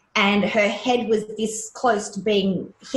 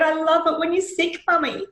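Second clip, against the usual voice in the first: son's words; 8 semitones higher than usual. Retold or imitated. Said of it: "he said".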